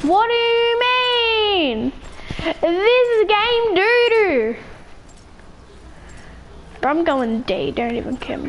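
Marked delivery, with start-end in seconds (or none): 0.1-4.6 high, drawn-out wail; whiny protest. Acted. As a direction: whine it slowly, stretching the words. Complaining about the game.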